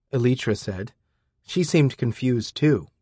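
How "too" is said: ordinary delivery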